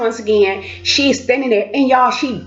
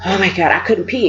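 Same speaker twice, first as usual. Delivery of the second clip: ordinary delivery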